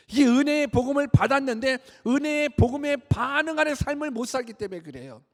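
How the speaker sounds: high, loud pulpit cry; impassioned sermon, voice pushed up; full-force preaching. Acted. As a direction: preach with mounting fervor.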